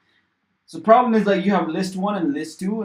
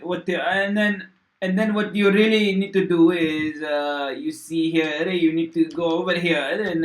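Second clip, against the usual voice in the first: loud, hammed-up Italian accent, Mario style; mock Italian accent; 4 dB louder than usual. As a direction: play a cartoon Italian plumber explaining code. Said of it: "Should I start speaking in fucking diff-, different accent?".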